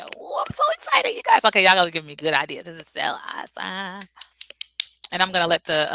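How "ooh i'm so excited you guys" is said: high squealing excitement; excited squeal. Real, not acted. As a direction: squeal it out, thrilled.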